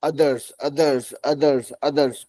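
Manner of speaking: others repeated slowly for practice; patient repetition of others; slow drill. Shown as a demonstration. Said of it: "पांच बार".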